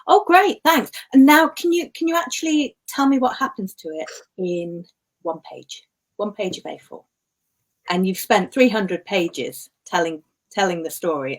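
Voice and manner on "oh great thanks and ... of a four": sarcastic smart aleck. Retold or imitated. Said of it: "some smart aleck comes along and says".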